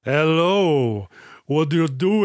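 slurry wine-cooler Dracula impression; drunk Dracula. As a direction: play a tipsy Dracula drawing out the hello. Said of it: "looking and sounding like a wine drunk, excuse me, wine cooler drunk Dracula".

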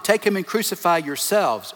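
ordinary talk

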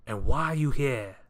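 loud, affronted demand; indignant questioning. A narrator's reading. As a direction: demand an explanation, fussy and put out. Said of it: "he asked over his shoulder".

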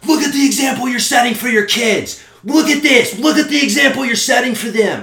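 high, loud, scolding shriek; mock screaming. Dog owner shaming him before his kids, mock screaming. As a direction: mimic her yelling at full tilt. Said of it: "she starts screaming at me like".

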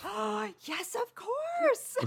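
high-pitched mock swoon of realization; gushing recognition. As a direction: gasp it out high, as if it all comes back. Acts out sudden gushing recognition.

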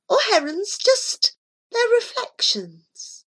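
high, light voice of the cat. Acted. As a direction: voice the cat Miss Scurry high and airy. Cat describes the lake.

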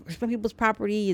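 usual speaking voice

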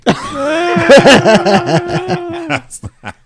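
loud, high shrieking laughter. Real, not acted. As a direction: howl with laughter.

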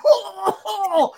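very high gossiping oh oh oh; mock shocked gasps. Mother hearing her son's school gossip, high mock shock. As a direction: play the thrilled gossip.

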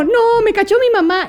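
high, loud mock child's dismay; little kid caught out. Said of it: "como niño chiquito". (niño chiquito cachado, agudo y exagerado)

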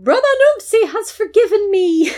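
high, loud, theatrical cry; hammed-up rejoicing. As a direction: proclaim it to the crowd. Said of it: "continue with the spectacle".

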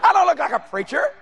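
ordinary delivery

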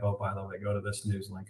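softly dropping the news link to friends; offhand quiet aside. Retold or imitated. Telling friends to check the news link, said quietly and offhand.

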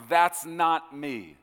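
emphatic denial. Retold or imitated. The Lord disowning the voice he heard, slow and emphatic. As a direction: stretch each word out forcefully.

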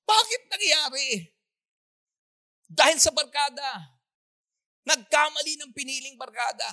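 loud, high, driving sermon; fiery pulpit warning; full-force preaching. Real, not acted. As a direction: preach it out to the congregation.

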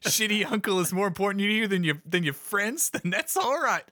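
mock-offended, with laughter breaking in; indignant lizard character. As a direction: protest in a high, wounded character voice. Plays lizard character arguing with Willie.

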